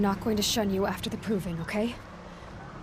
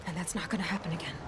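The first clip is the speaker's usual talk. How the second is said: whisper; 4 semitones lower, 7 dB quieter, faster than usual. Acted. Defiant vow after shunning talk.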